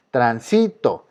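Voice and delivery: Transito said tran-SI-to; stress on middle syllable. Shown as a demonstration. Teacher placing the word by syllables, stressed SI in Transito. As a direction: lean on si in Transito. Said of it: "En este caso está en sí".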